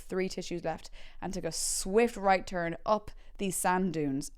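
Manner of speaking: normal talking voice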